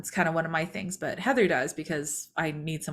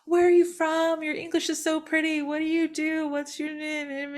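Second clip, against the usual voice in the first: imitating Heather, pitched much higher and bubbly; chirpy Heather; 10 semitones higher than usual. Heather quizzing the waitress over breakfast, much higher and bubbly. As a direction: play the eager morning chatterbox, bright and high.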